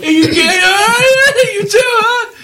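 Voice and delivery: very high, cartoonish bandmate voice; high goofy voice. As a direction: squeak it out like a goofy dude. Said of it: "each one of them just arrives afterwards, like".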